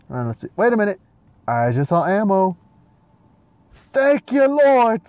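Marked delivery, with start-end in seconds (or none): 3.9-5.0 high, loud hallelujah cry; exultant relief. Pretend. Player thanking heaven for found ammo, exultant cry. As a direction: belt it out in grateful relief.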